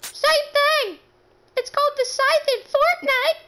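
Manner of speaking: loud, very high squeal; high-pitched arguing. Acted. Argues over the weapon's name.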